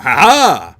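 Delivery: loud forced "ha ha"; mock laugh. Joking on the word normal, loud mock laugh. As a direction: punch out a fake, triumphant laugh.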